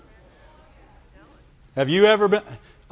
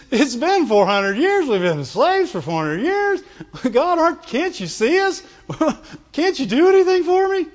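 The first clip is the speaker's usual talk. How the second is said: mock whine, pitched up on the pleas; whining; 7 semitones higher than usual. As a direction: whine it like a put-upon complainer. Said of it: "They, they whined".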